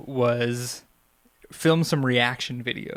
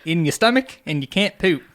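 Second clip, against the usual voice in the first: laughing through a bad Australian accent; hammed-up Australian doctor, high and loud; terrible Australian accent; 6 semitones higher, 3 dB louder than usual. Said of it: "That's my terrible Australian accent".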